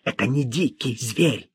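soft but cross hissing; hushed indignant protest; angry hiss. A narrator's reading. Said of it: "гневно прошипела она".

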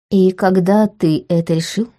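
ordinary delivery

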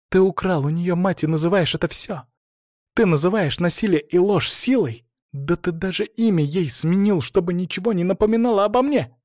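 high, indignant retort; bitter accusation. A narrator's reading. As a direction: throw his words back at him with rising outrage. Bitter quarrel, she accuses him. (горькое, возмущённое обвинение)

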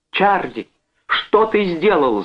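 very high, drawn-out alarm; horrified cry. A narrator's reading. Sister sees her husband struck, horrified cry. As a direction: cry out in horror. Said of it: "закричала Джоан в ужасе".

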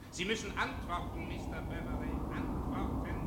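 distant, hushed voice calling for an answer; a far-off voice pressing from memory; echoing judge. Acted. Judge's voice echoing in the prisoner's head, distant and hushed.

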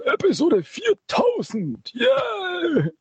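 put-on high, slow old-man voice. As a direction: creak it out like a grandpa. Joking about a seniors' podcast.

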